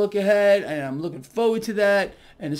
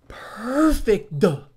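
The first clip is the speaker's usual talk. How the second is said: ordinary talk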